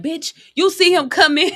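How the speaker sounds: high, loud yell at the screen; mock movie yell. Moviegoer yelling at a character in a scary movie, mock yell. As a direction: holler a warning at a film character, hammed up. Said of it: "I'm yelling like".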